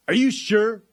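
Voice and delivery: high, loud shouted question; shouting over. Retold or imitated. State trooper doubting the onlooker's answer, shouted over. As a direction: holler it across as the imitated trooper. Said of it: "he kind of shouts over to me".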